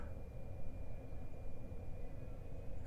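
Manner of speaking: long, quiet exhale; quiet, breath-only sigh; soft sigh. Acted. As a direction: let out a gentle sigh. Overwhelmed by partner's love.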